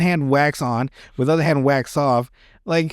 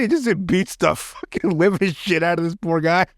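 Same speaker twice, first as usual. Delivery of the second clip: ordinary talk, nothing marked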